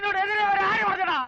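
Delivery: high, indignant yell; angry scolding. Acted. Master to disciple after botched dodge, angry high scolding.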